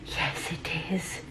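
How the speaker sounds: a secret little yes; whispered aside. Real, not acted. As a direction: whisper it, slow, to yourself. Answers own question under breath.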